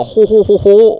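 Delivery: loud, high mocking ho ho ho. Quoting lazy stand-up comics, loud high mock laugh. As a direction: throw back the comic's cheap ho ho.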